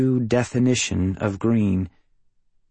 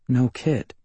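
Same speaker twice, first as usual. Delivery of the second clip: ordinary delivery, nothing marked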